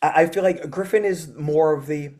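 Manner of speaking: usual speaking voice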